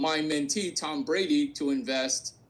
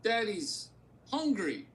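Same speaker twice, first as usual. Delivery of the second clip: higher, slow teasing daddy voice; mock coaxed daddy line; 5 semitones higher, 4 dB quieter than usual. Host telling the partner what to say, slow higher teasing voice. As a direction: drawl it out like a tease.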